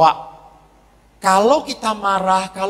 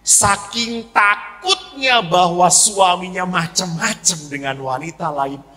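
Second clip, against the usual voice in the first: ordinary talk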